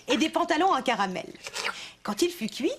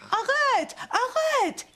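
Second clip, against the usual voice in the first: high, mock call after him; the fox calls out, much higher; fox calling stop; 11 semitones higher, much slower than usual. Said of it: "cria-t-il".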